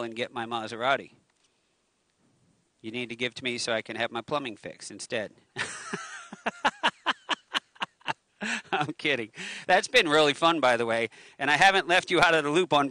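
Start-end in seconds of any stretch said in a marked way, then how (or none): 5.5-7.6 a high burst of real laughter at her own joke. Real, not acted. Preacher laughing at her own plumbing joke, high laugh. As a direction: break into a long high laugh.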